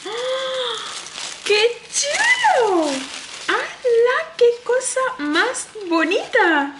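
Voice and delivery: very high, drawn-out squeal of delight; amazed delight. Real, not acted. Opening the cactus bag for viewers, high amazed squeal. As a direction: exclaim slowly and high, thrilled.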